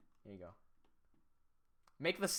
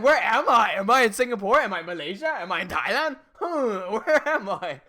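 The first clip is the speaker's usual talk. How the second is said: loud, higher hammed-up bewilderment; confused friend; 4 semitones higher, 10 dB louder than usual. Imitating Harry lost between countries, hammed-up bewilderment. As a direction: spiral into mock confusion. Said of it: "he gets so confused".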